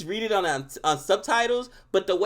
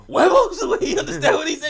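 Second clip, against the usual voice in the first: much higher, louder quoting the joke; mock surprised; 9 semitones higher, 7 dB louder than usual. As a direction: pop it up as a surprised question. Quoting the character.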